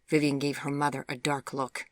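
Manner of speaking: usual speaking voice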